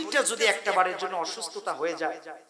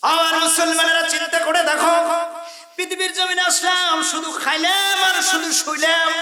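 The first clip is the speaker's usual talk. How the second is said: full-force preaching shout; 10 semitones higher, 7 dB louder than usual. Real, not acted. Preacher rebukes the Muslims, shouting high and loud. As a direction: cry out a rebuke to the faithful.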